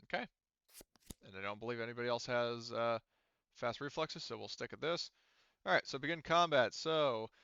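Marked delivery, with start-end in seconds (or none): none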